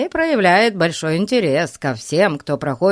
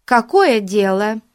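high, louder sharp demand; sharply suspicious, raised voice; 5 semitones higher, 5 dB louder than usual. A narrator's reading. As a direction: pounce on the question. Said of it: "резко спросила миссис Мун".

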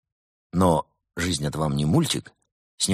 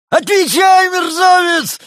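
bad cop shriek; 10 dB louder, slower than usual. A narrator's reading.

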